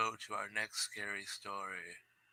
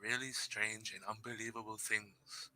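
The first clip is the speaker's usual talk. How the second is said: slow, dramatic opening, much louder at first; spooky story reading; 9 dB louder than usual. Acted. Streamer reading a scary story to his chat, slow and dramatic. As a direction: read it like a campfire ghost story.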